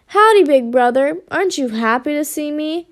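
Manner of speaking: ordinary delivery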